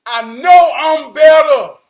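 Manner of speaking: slow, loud declaration, every word hit; emphatic shout. Real, not acted. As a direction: shout it slowly like a vow. Repeats line, second time shouted.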